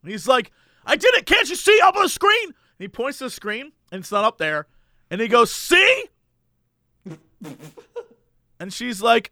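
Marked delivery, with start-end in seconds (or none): 0.8-2.5 high indignant customer; hammed-up outraged shopper; mock indignant insist. Angry customer quoted. 5.5-6.0 very high smug gotcha; hammed-up vindicated customer; mock triumphant see. Customer points at screen.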